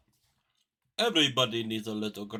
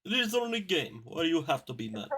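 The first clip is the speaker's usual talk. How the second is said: put-on hammy Russian accent, pitched up; 4 semitones higher than usual. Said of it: "You give him the very stereotypical Russian voice".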